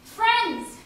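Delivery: high, loud official hailing the crowd; voice of authority. Retold or imitated. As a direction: call out like a pompous official addressing the masses. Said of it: "is heard the voice of authority".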